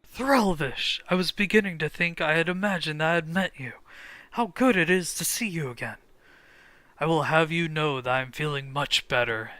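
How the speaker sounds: loud, proud warrior voice, boasting; eager young warrior. Acted. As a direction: declaim it like a brave young soldier. Voices a game character.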